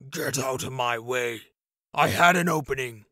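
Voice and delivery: angry knight, swelling to a loud bark at the end; frustrated fighter shoving past, growling; growled. A narrator's reading.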